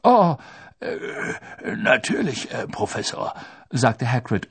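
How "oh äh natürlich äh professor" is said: halting, flustered giant; dazed, happy stammer; exhausted with happiness. A narrator's reading. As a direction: burst out "oh", then fumble along. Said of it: "offenbar erschöpft vor Glück".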